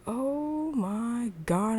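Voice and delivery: acted slow, dramatic disbelief; stunned realization. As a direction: stretch every word in dawning dismay. Vision's acted horrified realization.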